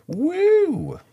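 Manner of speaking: a sudden very high, loud whoop; forced cheer. Pretend. Podcast host fakes excitement about getting old, high loud whoop. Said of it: "Yeah, let's get excited".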